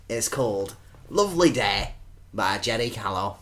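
slow, soft, pause-laden poem reading; reciting a home-made poem to Derek; poem recital. Acted. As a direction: read it with long solemn pauses. Reading his poem aloud.

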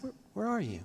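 hushed, aching question; curious, forlorn sadness. Acted. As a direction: call gently and sadly for someone lost. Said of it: "a forlornness and a, and a sadness".

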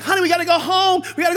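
loud, high acted parental call; dad calling out. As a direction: call over a noisy gym to a child. Father calls child to leave.

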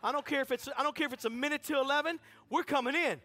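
loud, high, insistent demand; forceful demand. Acted. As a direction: build the pressure toward a shout. Acting out forceful demand, building.

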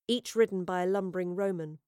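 ordinary delivery, nothing marked

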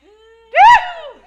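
loud high whoop with laugh; preacher's whoop. Real, not acted. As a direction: let out a joyful whoop.

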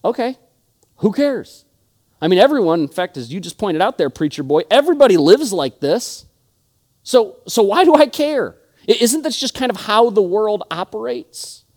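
high-pitched dismissive pushback; mock apathetic. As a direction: act out a listener shrugging off the preacher. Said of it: "take an apathetic mindset and say".

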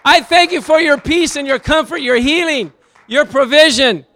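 loud, high praying shout; fervent prayer. Acted. Preacher showing how he prays, loud and fervent. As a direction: cry it out to the Lord in faith. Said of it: "I say".

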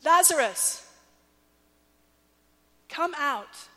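ringing shout to the grave; loud call. A narrator's reading. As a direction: call him out with full voice. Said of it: "he cried out in a loud voice".

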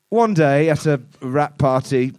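ordinary delivery

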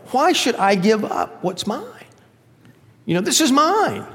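high-pitched mock child's protest. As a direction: play a possessive kid, pitch jumping up. Acted selfish child refusing to share.

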